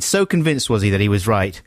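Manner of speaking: ordinary talk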